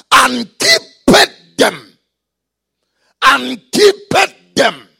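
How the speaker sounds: loud, slow preacher declaiming scripture; forceful scripture reading. Real, not acted. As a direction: hammer out each word of the verse, slowly.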